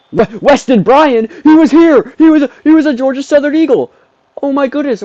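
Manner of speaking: very high, loud disbelief; shocked shouting. Real, not acted. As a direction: cry out on recognizing a former player. Shocked recognition of a former player.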